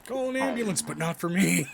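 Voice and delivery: high, loud meme delivery; meme shout. Pretend. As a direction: belt the meme line.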